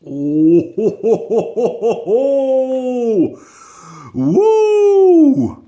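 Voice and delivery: high whooping cries after a sour sip; hooting high at the sourness; sour reaction whoops. Real, not acted.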